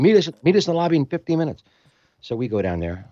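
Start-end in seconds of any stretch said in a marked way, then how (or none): 0.0-1.6 higher, fast order; startled soldier. Retold or imitated. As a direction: rush the command out. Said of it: "they're like".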